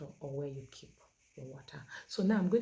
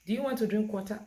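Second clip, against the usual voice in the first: quick, loud, higher full question; too much at once; 5 semitones higher, 6 dB louder, faster than usual. Shown as a demonstration. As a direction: rattle the question off at a child. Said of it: "that's too much for the child to process".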